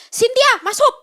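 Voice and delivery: high, loud call into the house; grandma shouting from the door. Retold or imitated. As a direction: yell her name to come inside. Grandma calling her inside.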